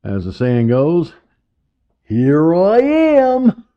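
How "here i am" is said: high, slow cartoon clown voice; a squeaky animated clown; Bozo the Clown voice. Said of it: "the animated Bozo the Clown voice".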